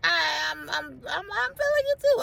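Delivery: squeaky high mock-giddy voice; mock flirty reply. To a man who asked her out, mock giddy. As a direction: play it giddy and flattered, way up high. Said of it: "I'm like".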